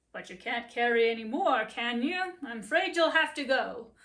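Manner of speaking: a stern owner breaking bad news; gruff master. Master voiced in story.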